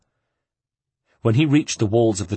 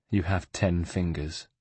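much softer, lowered murmur; 3 semitones lower, 7 dB quieter than usual. A narrator's reading. Ezio murmurs to the monk, softer and disappointed. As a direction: say it half to himself, disappointed. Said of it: "he murmured".

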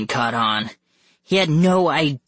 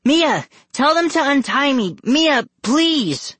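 very high, loud pleading; high-pitched wail of appeal; desperate cry; 14 semitones higher, 5 dB louder than usual. A narrator's reading. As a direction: plead frantically as a captive girl. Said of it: "Scarlet cried".

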